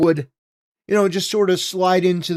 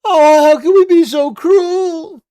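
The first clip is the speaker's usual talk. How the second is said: much higher, louder, slow pearl-clutching lady voice; hammed-up fainting-couch wail of I do declare; mocking a woman pleading against immigration laws in a shrill falsetto; mock Southern belle; 12 semitones higher, 8 dB louder than usual.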